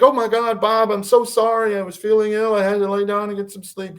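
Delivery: high, pleading imitated wife; flustered retold excuses; upset apology. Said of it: "She's upset".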